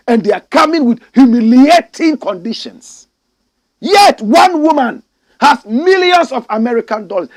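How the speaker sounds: loud, high angry tirade; furious rant. Real, not acted. Ranting at a foolish president, loud and furious. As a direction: tear into them at full volume.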